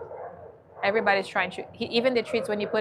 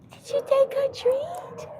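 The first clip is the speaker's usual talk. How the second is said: high, slow cooing pet voice; much higher, slow doggy talk. Real, not acted. Talking sweetly to shy dog.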